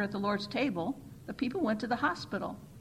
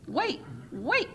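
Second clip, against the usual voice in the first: cried out; 8 semitones higher than usual. A narrator's reading. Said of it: "cried the chairperson".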